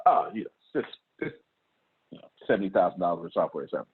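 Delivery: fast, low, offhand mumble; downplaying. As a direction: shrug it off as small. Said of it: "I do that on purpose".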